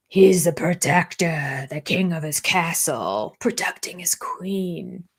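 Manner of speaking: slow, drawn-out grandiose delivery; mock-grand. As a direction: proclaim it slowly, fading at the end. Mock-grand summary of his role.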